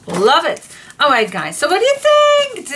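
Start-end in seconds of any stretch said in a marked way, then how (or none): 1.6-2.7 very high, loud, eager question; eager excitement. Real, not acted. Crafter asking viewers about her papers, excitedly eager. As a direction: pitch it way up and call it out.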